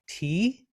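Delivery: "tea" sliding upward, high; isolated "tea" with an upward inflection; rising question tone. Shown as a demonstration. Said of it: "with the sound of the word sort of rising upward".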